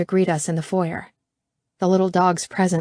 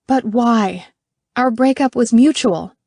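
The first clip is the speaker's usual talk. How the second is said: indignant; 5 semitones higher, 6 dB louder than usual. Acted. Indignant protest.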